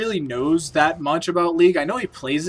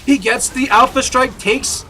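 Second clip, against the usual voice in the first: higher, louder hype call; excited play-by-play; 6 semitones higher, 7 dB louder than usual. Real, not acted. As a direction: sell the big play. Caster hypes a big play.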